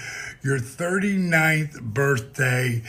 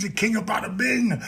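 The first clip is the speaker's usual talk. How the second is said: ordinary delivery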